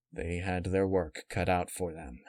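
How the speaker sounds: ordinary delivery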